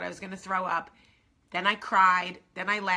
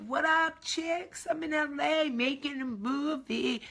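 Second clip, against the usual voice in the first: much higher, slowed, show-off diva voice; future Hollywood self; 8 semitones higher, slower than usual. Pretend. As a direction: play the big-shot in LA, bragging. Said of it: "you will see me in L A like".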